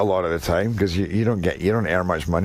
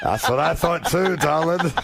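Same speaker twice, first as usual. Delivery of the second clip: higher, slower broad Glasgow accent; teasing the host in a Glasgow accent; 9 semitones higher, slower than usual. Shown as a demonstration. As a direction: flirt it in thick Glaswegian. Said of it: "the Glasgow accent".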